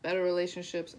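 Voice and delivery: ordinary talk, nothing marked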